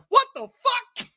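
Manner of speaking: high, slow stunned what the fuck; mock disbelief. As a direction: act shocked at the scene. Reacting to basketball scene.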